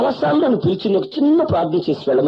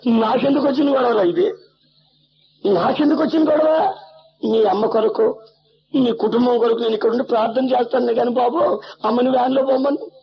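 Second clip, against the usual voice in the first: sarcastic scorn; 9 semitones higher than usual. Acted. Sarcastic preacher rebuke.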